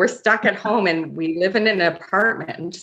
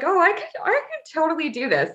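much higher-pitched, mock-confident; naive confidence; 12 semitones higher than usual. Retelling her decision to wax at home, naively confident. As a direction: play your overconfident past self.

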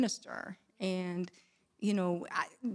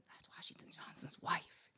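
hushed, voiceless gossip whisper; imitated whisper; 15 dB quieter than usual. Imitating people whispering as she walks by, whispered. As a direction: whisper it like onlookers talking behind her back. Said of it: "I hear people whispering".

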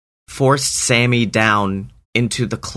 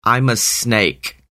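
contemptuous snake. Acted. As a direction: drag out the insult like a lightly voiced snake. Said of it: "he says".

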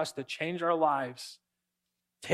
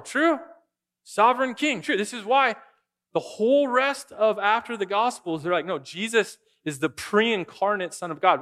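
raised, louder preaching with quick high "true?" checks; fervent preaching; 5 semitones higher, 4 dB louder than usual. Acted. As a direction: drive it home to the congregation at full force. Preaching at full force.

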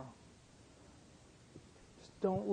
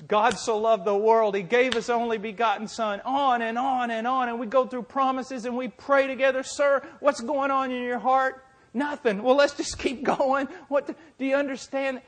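high, loud, impassioned preacher's cry; full-force preaching; 8 semitones higher, 10 dB louder than usual. Acted. Preacher retelling his urgent argument with a dying man, full-force preaching. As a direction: preach it at full volume, acting out both sides. Preaches retold scripture and exchange.

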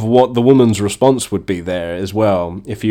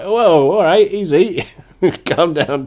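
acted alarmed placating; 6 semitones higher than usual. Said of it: "You're like".

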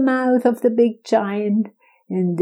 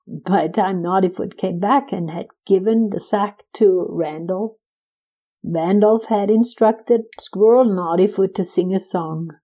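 usual speaking voice

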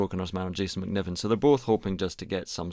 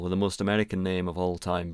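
ordinary delivery

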